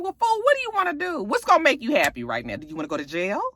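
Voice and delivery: high, fast exasperated rant. Real, not acted. Recapper ranting at A J in her head, high and fast. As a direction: rattle it off at him, pitch climbing. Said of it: "I'm thinking to myself".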